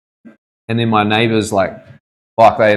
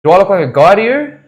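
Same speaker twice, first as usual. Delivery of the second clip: mock outraged neighbor; 9 semitones higher, 5 dB louder than usual. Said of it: "And she's like".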